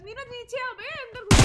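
high, loud call to a teammate. Real, not acted. Player yelling for Neeraj to come inside, loud call. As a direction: shout him down and inside.

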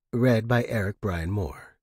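usual speaking voice

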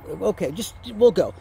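ordinary delivery

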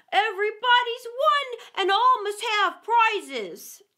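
loud, high grand announcement; proclaiming. A narrator's reading. Dodo announcing the verdict to the racers, loud and triumphant. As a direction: declare it to all, drawing out the ending.

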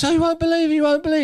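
high, loud, breaking the shocking news; mock hysteria, pitched right up; retold frantic gossip. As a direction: act out someone bursting in with news. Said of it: "calm down".

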